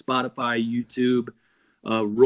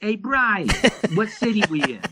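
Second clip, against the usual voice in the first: high-pitched mimicry of a befuddled bandmate; Johnny; 9 semitones higher than usual. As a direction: call out high, imitating a bandmate who's lost track of things. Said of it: "Have you done your Johnny impression".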